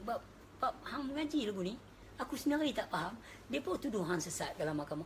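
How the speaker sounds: ordinary delivery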